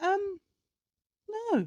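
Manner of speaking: very high mock-innocent boyfriend voice; high-pitched imitation of his answer; imitated clueless boyfriend. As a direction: pitch it way up, playing him oblivious. Boyfriend sees no issue, retold.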